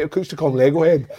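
ordinary delivery

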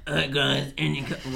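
frightening manager voice with real gravitas; Sean Dyche. Acted. Podcaster imitating the manager calling players in, frightening growl. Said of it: "Sean Dyche, his voice".

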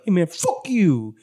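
mock angry bee. Game master re-enacts bee's gesture, drawn-out mock yell. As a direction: stretch the insult out, hammed-up.

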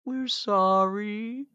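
slow, drawn-out high mock sorry; hollow apology. The parody CEO on a rug, slow mock sorry. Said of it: "laying naked on a rug going".

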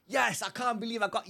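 contained, squealed-up imitation of a celebration yell; retold joyful scream. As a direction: act out screaming for joy without the volume. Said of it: "screaming on top of my lungs".

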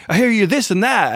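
high, animated mock chatter; imitating eager host. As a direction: pitch it up like you're really into it. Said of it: "really getting into it".

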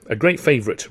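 usual speaking voice

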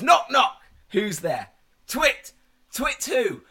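imitated child telling a joke, high and loud; Ren. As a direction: build a kid's joke to a yelled punchline. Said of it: "She goes".

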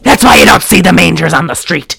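loud mock-paranoid tirade; lunatic conspiracy theorist. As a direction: fire off conspiracies like a ranting madman. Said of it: "that's exactly how he sounded".

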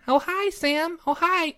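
high singsong "Oh, hi, Sam"; playful high greeting. Pretend. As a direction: lilt it up high.

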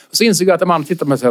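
normal talking voice

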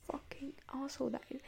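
hushed, breathy swear under the breath; a whispered jab at a hated teacher; whispered insult. Real, not acted. Aside insult whispered to camera.

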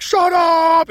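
big, high drawn-out shout; loud, long imitation of a yelling woman; superpower yell. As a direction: bellow it as a mock screech. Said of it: "her yelling superpower".

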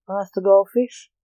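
usual speaking voice

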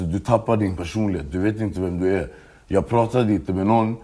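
ordinary talk

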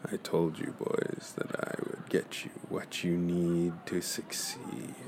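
quiet, half-whispered captain's voice; soft ghostly voice. Acted. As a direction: speak like a fading spirit. Captain's voice returns softly.

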